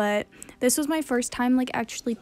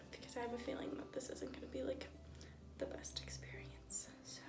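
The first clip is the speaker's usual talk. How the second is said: whispering; 12 dB quieter than usual. Real, not acted. Nervous aside before brushing teeth.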